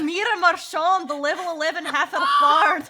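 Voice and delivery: light mock Scottish accent; a hint of a Scottish accent throughout. Player introducing her bard in a lightly Scottish accent. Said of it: "I think I went for Scottish here".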